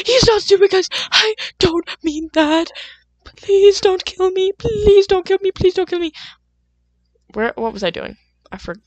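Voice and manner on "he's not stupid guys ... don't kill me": high, pleading mock panic. Begging the singer's fans not to attack, high mock pleading. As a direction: beg the fans for mercy in jest, high.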